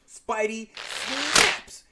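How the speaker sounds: high, loud, stretched-out outburst; dramatic exclamation. Pretend. Host recapping Spidey's breakdown, dramatic drawn-out cry. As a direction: hit it loud and draw out the verb.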